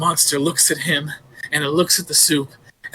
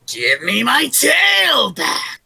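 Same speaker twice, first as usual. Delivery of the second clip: spooky monster shout; 7 semitones higher, 4 dB louder, much slower than usual. Acted. Creature calling again.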